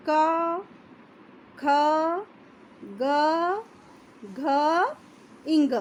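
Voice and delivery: each letter क, ख, ग, घ, इंग said apart with long pauses; slow letter demo. Shown as a demonstration. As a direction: spell out क ख ग घ इंग one by one, clearly. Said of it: "आप इसको उच्चारण करके देखिए". (कंठ से धीरे-धीरे उच्चारण)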